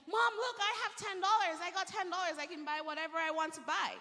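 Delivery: put-on high, bright-eyed shout of a thrilled boy; excited kid. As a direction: show Mom the money, bursting with glee. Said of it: "he was so excited".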